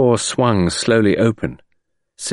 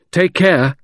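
higher, urgent warning; exclaimed; 9 semitones higher, 3 dB louder than usual. A narrator's reading. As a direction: call a quick warning up the tree. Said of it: "I exclaimed".

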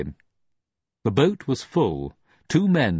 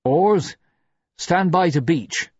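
commanding; 10 semitones higher, 4 dB louder than usual. A narrator's reading. Officer commanding the rowers.

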